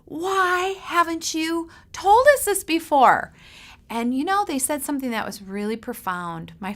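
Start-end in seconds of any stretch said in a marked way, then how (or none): 0.0-3.3 very high, loud astonished outcry; stunned amazement. Retold or imitated. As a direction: react as if it's news to you. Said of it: "we were like".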